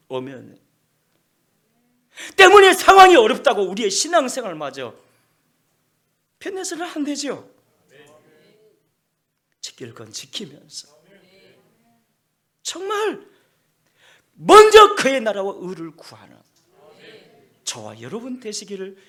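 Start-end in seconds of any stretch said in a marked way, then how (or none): 2.4-7.5 loud, high preaching voice; full-force sermon delivery; forceful preaching. Acted. Preaching peak. 12.6-16.4 loud, high preaching voice; full-force sermon delivery; forceful preaching. Acted. Preaching peak.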